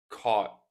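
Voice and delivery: "caught" with the last T swallowed; silent T. Shown as a demonstration. Teacher repeats the example word, "caught" with silent T. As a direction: leave the T of "caught" unreleased. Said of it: "again the silent or flap T".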